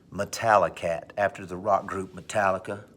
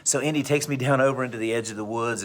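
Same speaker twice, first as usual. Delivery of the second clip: usual speaking voice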